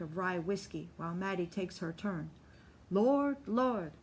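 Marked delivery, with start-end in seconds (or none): 2.9-3.9 higher, louder call to the Lord. A narrator's reading. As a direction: lift it up to heaven. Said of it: "Mattie calls".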